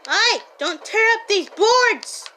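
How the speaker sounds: high, loud, slow yell; angry shout. Real, not acted. Yelling at a zombie tearing boards off the window. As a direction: bark the order at the zombie.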